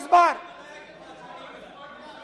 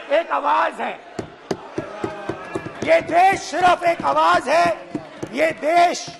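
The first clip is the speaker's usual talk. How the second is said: booming political rally speech. Real, not acted. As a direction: address the crowd like a rally stage. Addresses brothers and sisters rally-style.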